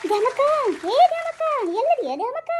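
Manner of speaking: loud, high calling out; hollering. Acted. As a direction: shout for someone out of sight. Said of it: "ಯಾಕಪ್ಪ ಹಿಂಗ್ ಚೀರಾಡಕತ್ತ".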